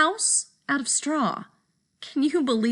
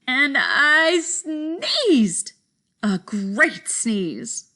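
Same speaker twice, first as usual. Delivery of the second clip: sneeze told slowly; 2 semitones higher, much slower than usual. Acted. Wolf telling of great sneeze.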